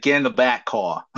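badly faked Boston accent. Host imitating the actor's brother, bad Boston accent. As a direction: do a clumsy Boston impersonation. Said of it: "I'm terrible at it".

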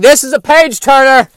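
high, loud, hammed-up enthusiasm; mock enthusiastic reader. Imagined guy reading Dune, mock thrilled. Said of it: "sitting there reading Dune going".

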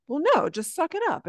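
mock telling yourself to get on with it; dismissive self-talk. Acting out brisk self-talk.

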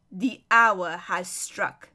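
very slow, low, weighty verdict; solemn pronouncement. Acted. Lion cutting off the prince's rant, solemn. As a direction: toll each word gravely.

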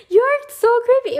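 loud, very high disgusted cry; high loud disgusted yell; creeped-out shout. Real, not acted. Outburst at stalker's behaviour.

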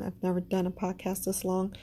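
ordinary talk, nothing marked

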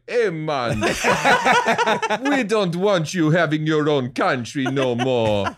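slow, laughing Jamaican put-on; Jamaican accent. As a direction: drawl it out in a comic Jamaican accent. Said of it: "when you do the Jamaican accent".

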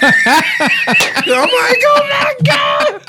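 high, slow, laughing exclamation; laughing shout. Real, not acted. Cracking up at his own confession, shouting. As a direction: cry out through laughter.

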